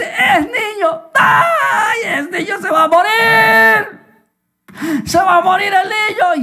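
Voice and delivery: high-pitched, drawn-out cry of alarm; panicked wail. Acted. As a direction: wail it like a horrified midwife. Midwife panics at forbidden birth.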